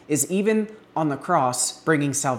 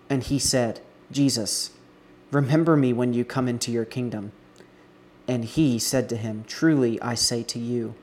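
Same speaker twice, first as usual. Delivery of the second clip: measured Bible reading with the criminals' lines voiced louder; scripture reading; 5 dB louder than usual. Pastor reading Luke to his church, measured scripture voice. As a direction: read the passage to the congregation with weight.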